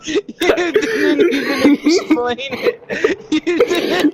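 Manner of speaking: high, loud, slow words broken by laughter; cracking up while complaining; laugh-cry through the words; laughing protest. Real, not acted. Laughing at a botched story.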